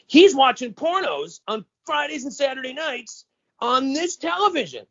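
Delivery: high, sneering tattletale voice; tattling brother. Retold or imitated. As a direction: rat him out in a high mocking tone. Gino tattles to parents.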